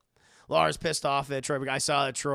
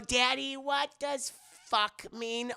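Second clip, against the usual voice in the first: put-on child voice; 9 semitones higher, slower than usual. Voicing the daughter.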